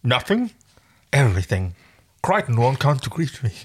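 words mangled by a finger in the mouth. Pretend. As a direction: talk around a finger in the mouth. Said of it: "Like that finger in your mouth".

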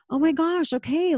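lightly acted relief, high; relieved realization. Voices relieved reaction after laughing.

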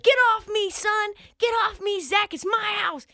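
high, brash quarterback taking charge; cocky quarterback. Retold or imitated. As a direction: push the coach aside, high-pitched. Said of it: "Joe Burrow's like".

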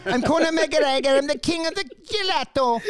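put-on lightly done Irish accent. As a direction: brag like a cocky Irish fighter. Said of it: "I can't even do the accent".

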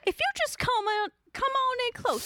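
high, eerie come-closer voice; creepy coaxing. Acted. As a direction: lure them in close and creepy. Creepy dilly invites closer.

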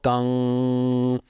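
a held, humming imitation of a struck gong; sung gong tone. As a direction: hum out the ring of a gong and let it hang. Imitating a gong note in lesson.

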